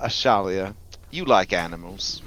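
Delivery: ordinary delivery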